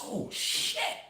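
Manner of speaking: hushed, breathless whisper; stunned whisper; whispered oh shit. Retold or imitated. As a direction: whisper it in shock. Reacting to shocking news.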